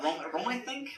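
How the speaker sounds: imitating a clueless friend groping for a word; mock-hesitant, questioning; mock unsure. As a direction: tease someone unsure of the name, pitch lifting at the end. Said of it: "had even trouble ... naming the word rum".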